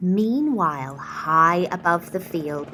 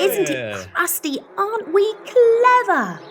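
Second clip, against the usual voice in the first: high, loud and gleeful villagers; proud shout; 12 semitones higher, 4 dB louder than usual. A narrator's reading. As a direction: crow with pride at the bread. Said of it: "the villagers raised a mighty shout".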